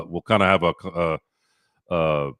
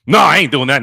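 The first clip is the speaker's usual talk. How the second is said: a louder, slightly raised mock refusal from a timid friend; 4 semitones higher, 5 dB louder than usual. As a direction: imitate the friend turning it down firmly. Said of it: "he's always, you know".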